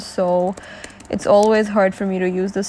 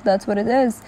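ordinary delivery

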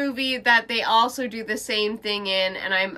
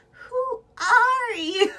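high, slow, dazed question through laughter; mock confused wife; 13 semitones higher, much slower than usual. Mocking the wife who has lost her memory. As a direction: drag it out like a baffled amnesiac. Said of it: "she's gonna be like".

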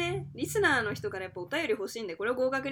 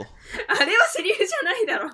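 blurted out through laughter, higher and louder; laughing retort; 9 semitones higher, 7 dB louder than usual. Real, not acted. 共演者の言い訳に笑いながら突っ込む、笑い声で. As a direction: laugh while shooting down the excuse.

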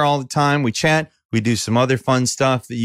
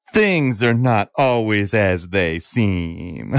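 slow, lower dramatic tagline; ominous tagline; 4 semitones lower, much slower than usual. Acted. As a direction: intone it like a mysterious trailer. Dramatic opening line.

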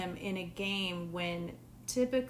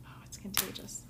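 whispering; 9 dB quieter than usual. Real, not acted. Sniffing smelling salts, whispered.

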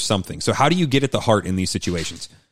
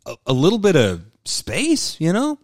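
whiny tired husband role; 8 semitones higher than usual. Pretend.

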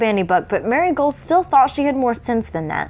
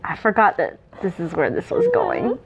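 choked up. Real, not acted. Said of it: "It's okay, breathe".

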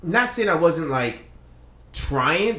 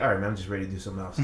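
fast muttered aside; 8 semitones lower, 7 dB quieter, much faster than usual. Retold or imitated. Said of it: "I was like".

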